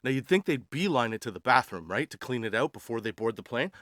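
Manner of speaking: usual speaking voice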